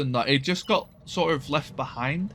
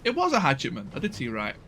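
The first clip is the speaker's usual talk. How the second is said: usual speaking voice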